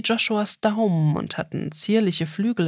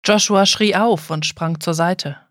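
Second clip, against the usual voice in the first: ordinary delivery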